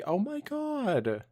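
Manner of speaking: high, slow horrified exclaim; drawn-out shocked outcry; shocked gasp. Acted. Reacting to the toddler death.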